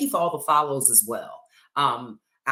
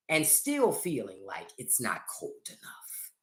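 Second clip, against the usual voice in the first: whispered punchline; 3 semitones lower, 7 dB quieter than usual. Acted. Exaggerating her hot flash heat.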